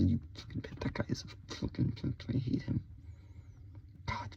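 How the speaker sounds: hushed, bitter muttering; whispered venting. Acted. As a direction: spit the curse in an unvoiced whisper.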